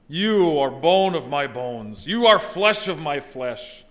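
slow loving declaration. Shown as a demonstration. Husband declaring love to his wife on their anniversary. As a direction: say it slowly like a vow. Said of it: "Remember that on your next anniversary".

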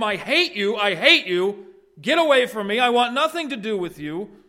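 high, loud furious shouting; raging hatred. Pretend. Sinful people raging at God, loud furious shouting. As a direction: hurl it at him like a rebel.

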